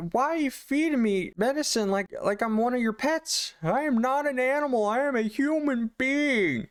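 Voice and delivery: high, strained outraged rant; mock rage. Husband fed medicine like a pet, enraged. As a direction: act out a husband losing his temper, slowing on the last words. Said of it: "the temper, the rage".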